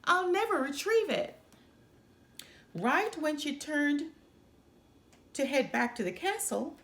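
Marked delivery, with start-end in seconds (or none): 0.0-1.4 high, despairing princess voice; whiny princess. Acted. Older sister mourning her lost ball, high and whiny. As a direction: sulk that it's lost for good. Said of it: "the older sister said".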